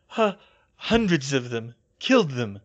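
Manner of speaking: halting, shaken character voice; stammer, then force the words out loudly; broken, raspy utterance; rasping Bill. A narrator's reading. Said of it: "Bill rasped".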